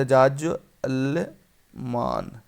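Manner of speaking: ऐसे लिखा जाजलमान said very slowly; slow dictation. Shown as a demonstration. Teacher slowly showing students how to write it. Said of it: "कैसे लिखा जाएगा?".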